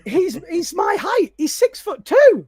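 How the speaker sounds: amazed. Real, not acted. Stressing Ocon's surprising height.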